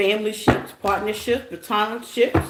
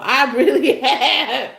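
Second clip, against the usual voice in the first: slow, loud words broken by a laugh; 4 semitones higher, 5 dB louder, slower than usual. Real, not acted. Reader admits her petty mood, laughing aloud. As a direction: giggle through the confession. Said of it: "I have been in this petty mood".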